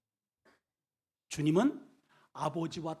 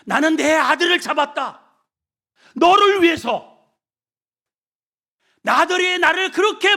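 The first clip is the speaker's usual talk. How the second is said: higher, louder, impassioned declaration; God's fervent answer; 10 semitones higher, 14 dB louder than usual. Acted. God answering the complainer, loud impassioned declaration. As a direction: proclaim it as God pleading. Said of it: "하나님 말씀하셔요".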